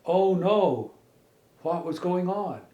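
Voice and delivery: plain reading voice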